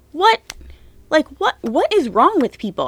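high, loud disbelieving "What?"; shocked and outraged, voice jumping up; incredulous outburst. Real, not acted. Vlogger raging at people who blame the murdered wife, incredulous.

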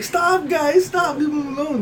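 high-pitched imitation of a girl protesting; pleading girlfriend. Girlfriend calls off the guys teasing her ex, high mock pleading. Girlfriend tells friends to stop.

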